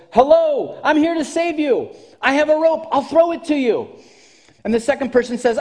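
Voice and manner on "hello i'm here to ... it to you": high, loud hail; calling down from the ship. Retold or imitated. Captain calling to the second person in the water, loud and high. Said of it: "The captain calls down to the second person and says".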